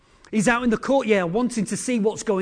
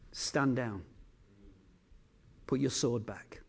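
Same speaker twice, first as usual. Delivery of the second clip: much lower and softer, a calm command; quiet command; 8 semitones lower, 11 dB quieter than usual. Acted. The Lord quietly tells the archangel to stand down, hushed command. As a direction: hold him back with a hushed word.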